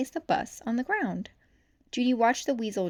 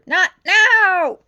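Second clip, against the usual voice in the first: much louder, higher yell; angry shout; 11 semitones higher, 16 dB louder than usual. A narrator's reading. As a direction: lose patience now. Chief losing patience.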